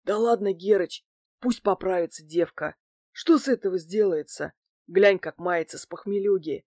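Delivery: lightly husky small grey-haired crony; husky wheeze. A narrator's reading. Crony talks the bald man round for the girl, in a slight husky wheeze. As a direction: rasp it a little, placatingly. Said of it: "седой стриженный подъёжик просипел".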